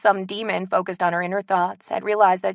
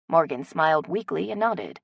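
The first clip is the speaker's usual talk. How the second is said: ordinary delivery, nothing marked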